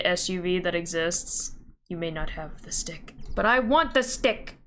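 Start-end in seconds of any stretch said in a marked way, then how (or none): 1.9-3.1 whisper. Acted. Whispering claim to the stick.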